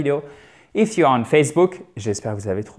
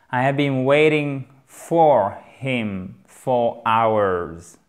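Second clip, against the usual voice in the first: slow model sentence; much slower than usual. Shown as a demonstration. Teacher models the sentence slowly.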